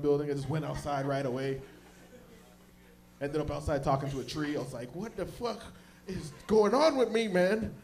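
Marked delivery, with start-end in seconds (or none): 4.9-7.7 high, loud and slow, lost in the head; a stoned guy who can't make sense of himself; stoned bewilderment. Retold or imitated. High, talking to a tree.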